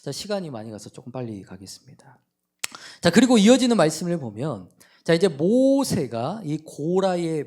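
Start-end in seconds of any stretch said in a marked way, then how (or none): 0.0-2.2 whispered aside. Real, not acted. Aside to congregation about time.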